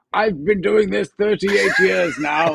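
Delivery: slow, laughing mock veteran; lightly played grizzled crew veteran; old-timer voice. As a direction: drawl it out like a weary old hand, half laughing. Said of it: "I'm now the person going".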